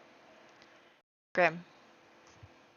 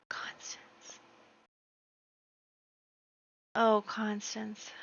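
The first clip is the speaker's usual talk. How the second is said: slow, whispered heartbreak; dismayed whisper; 9 dB quieter, slower than usual. Real, not acted. Player whispering in shock as Constance falls. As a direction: mourn her under your breath.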